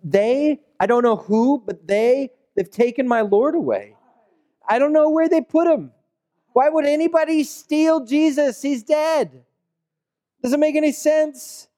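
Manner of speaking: high, pleading grief at the empty tomb; distraught Mary. Acted. Mary at the tomb, asking who took her Lord, distraught. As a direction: voice Mary lost and bewildered.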